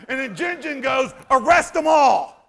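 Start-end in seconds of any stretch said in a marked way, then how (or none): 1.3-2.4 loud, raised-pitch cry of an angry mob; mock outraged shout. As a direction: shout it like an overwrought commenter. Mocking an outraged Facebook commenter.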